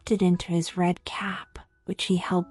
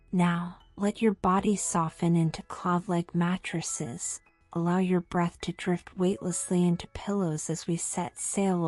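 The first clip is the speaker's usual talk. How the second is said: soft sleep meditation voice; 3 dB quieter than usual. Acted. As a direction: lull the listener gently to sleep. Sleep outro after the story.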